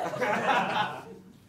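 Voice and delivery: real laugh. Real, not acted. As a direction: crack up.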